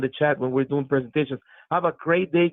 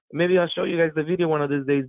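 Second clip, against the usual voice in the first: ordinary talk, nothing marked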